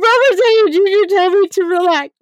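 high, loud tearful wail; mock emotional. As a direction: wail it like an overwhelmed fan. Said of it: "that was a fucking emotional scene".

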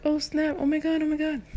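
put-on high thrilled teen gasps; excited squeal. Teen hearing the band is coming, squealing with excitement. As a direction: gush like a starstruck teenager.

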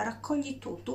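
ordinary talk, nothing marked

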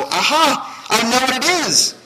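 loud, high-pitched eureka; triumphant realization. Acted. Preacher voicing a listener who found the way in, triumphant. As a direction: burst out as if the answer just struck.

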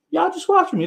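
very high, teasing falsetto; squeaky mock voice. Streamer teasing his viewers, very high mock voice. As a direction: push it up into a squeak.

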